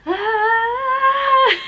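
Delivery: high, loud cry of pushing; real effortful yell through a contraction; sudden high strained shout; straining cry. Real, not acted. Big contraction during birth.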